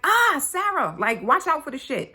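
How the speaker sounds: put-on startled, high-pitched shout to a child; warning yell. Mother warns her child about to step in mess, warning yell. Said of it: "yelling as a warning".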